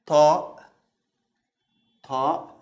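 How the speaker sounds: T with the throat opened; strong throaty T in "ta"; emphatic Arabic T. Shown as a demonstration. Teacher showing learners the Arabic letter, emphatic T. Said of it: "we open the throat a little bit".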